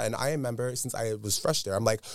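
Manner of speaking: normal talking voice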